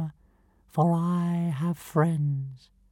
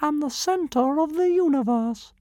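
much higher, puffed-up tiny creature; grand squeak; 8 semitones higher than usual. Acted. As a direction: squeak it out with self-importance. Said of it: "squeaked the tiny creature grandly".

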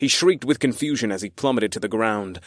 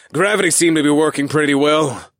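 snarky; 4 semitones higher, 6 dB louder than usual. A narrator's reading. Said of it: "I snarked".

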